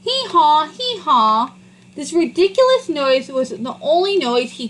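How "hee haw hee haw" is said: harsh hee-haw. A narrator's reading. Donkey cries out for the first time, a harsh braying hee-haw. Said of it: "the harsh sound of his voice".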